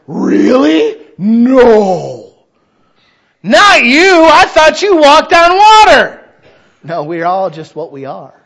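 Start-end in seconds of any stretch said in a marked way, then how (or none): 0.1-6.2 high, loud, mocking disbelief, slowing at the end; mock shock. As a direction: play up the fake astonishment. Mock shocked reply to confession.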